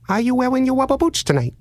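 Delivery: high, sing-song creepy caller voice; odd prank caller. Retold or imitated. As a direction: play the weird caller way up high. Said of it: "the voice at the other end says".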